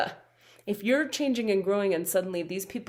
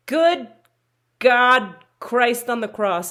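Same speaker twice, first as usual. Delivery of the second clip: exasperated exclamation; 3 semitones higher, 9 dB louder, slower than usual. Real, not acted.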